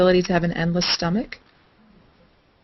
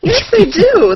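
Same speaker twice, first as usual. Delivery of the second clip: high, louder mock insistence; playful insistence; 12 semitones higher, 7 dB louder, slower than usual. [name] insisting back at co-host, high playful retort.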